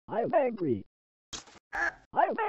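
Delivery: high, strained "angry" with a put-on voice; squeaky mock anger. Comic character repeating that he is angry, high cartoonish fake anger.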